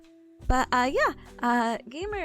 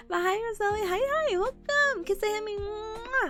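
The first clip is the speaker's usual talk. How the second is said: cute anime streamer; 8 semitones higher than usual. Acted. Cute greeting to viewer in chat.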